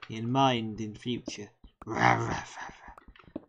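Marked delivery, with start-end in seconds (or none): 1.9-3.4 clumsy zombie rawrs fading to a whisper; zombie noises. Retold or imitated. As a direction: ham up some zombie growls. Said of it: "I kinda butchered the zombie noises there".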